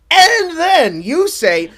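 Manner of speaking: usual speaking voice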